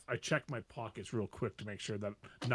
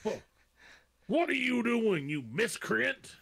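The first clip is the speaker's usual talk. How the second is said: high, loud, drawn-out outrage; indignant knight; 10 semitones higher, 6 dB louder than usual. Acted. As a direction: bellow it slowly, in pompous indignation. Knight outraged at kobold colliding with him.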